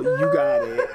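high-pitched laugh as she gives up. Real, not acted. Conceding the argument to her friend, high laughing. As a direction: collapse into squealing giggles.

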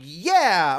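loud, drawn-out "yeah"; emphatic agreement. Retold or imitated. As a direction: agree with hammed-up conviction. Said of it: "in the back of my head, I'm like".

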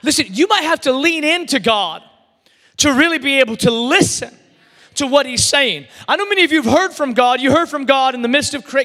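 ordinary talk

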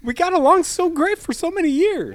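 high, loud, wounded protest; hammed-up shouted lament; mock heartbroken outcry. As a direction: wail like a betrayed friend. Mock lovers' quarrel with gravity.

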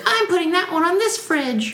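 put-on very high, proud little kid; high child voice. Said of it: "you would be like".

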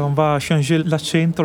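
normal talking voice

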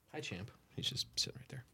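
hushed greeting to the dog; a quiet aside whispered to the dog nearby; soft whisper. Real, not acted. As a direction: whisper so as not to disturb him.

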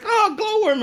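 very high, loud squeal of delight; a little girl spotting her toy; child's delight. Retold or imitated. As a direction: daughter finding her glowworm, high and delighted. Little daughter finding toy.

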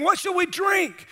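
slow, high-pitched complaint; mock griping. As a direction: whine it like a grumbling crowd. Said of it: "They're griping, complaining".

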